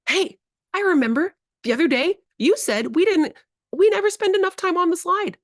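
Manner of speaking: high, loud playful announcement; telling a kid they're going to the slide; excited surprise. Shown as a demonstration. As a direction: tease them with a big surprise. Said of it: "just beat 'em to the punch".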